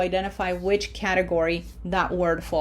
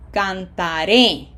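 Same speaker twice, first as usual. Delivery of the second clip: Cantaré, slow, with a louder RÉ; stress on last syllable; 5 dB louder, slower than usual. Shown as a demonstration. Teacher letting students verify pronunciation, Cantaré stressed on RÉ. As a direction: land hard on the final RÉ of Cantaré. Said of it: "red syllable is what has pronunciation".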